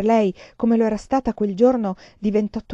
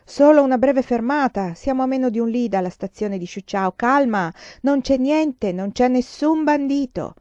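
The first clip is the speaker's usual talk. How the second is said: higher, louder calming call; 6 semitones higher, 5 dB louder than usual. Acted. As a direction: call down the carriage to calm them. Conductor calming passengers. (capotreno che chiama forte per calmare)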